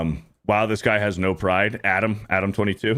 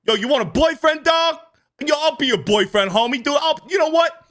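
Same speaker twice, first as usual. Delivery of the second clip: high, hammed-up homie voice building higher; mock street tough; 10 semitones higher than usual. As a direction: parody a tough guy getting ever more excitedly lovestruck. Mocking the video man, escalating into courtship.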